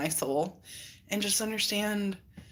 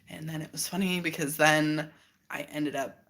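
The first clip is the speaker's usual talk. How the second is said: ordinary delivery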